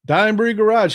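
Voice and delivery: usual speaking voice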